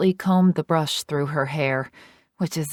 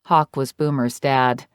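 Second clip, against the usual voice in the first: usual speaking voice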